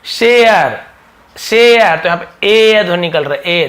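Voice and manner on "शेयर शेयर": "शेयर" louder, ay gliding into a; demonstrating the ay-a sound. Shown as a demonstration. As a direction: say "शेयर" as a model word, falling at the end. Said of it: "ए ध्वनि भी निकलता है". ("शेयर" में ए-अ की ध्वनि ज़ोर से)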